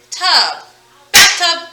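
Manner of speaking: loud blended bathtub; smushed together. Shown as a demonstration. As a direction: blend tub, then bathtub as one word. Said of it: "Bathtub smushed together".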